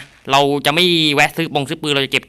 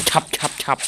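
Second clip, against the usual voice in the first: whispered slash sounds; 6 dB quieter than usual. Pretend.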